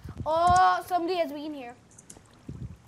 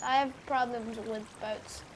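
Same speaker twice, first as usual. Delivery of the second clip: usual speaking voice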